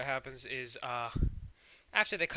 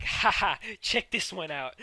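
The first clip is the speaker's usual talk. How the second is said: mock boast; 5 semitones higher, 7 dB louder than usual. Ruby and Sapphire boast.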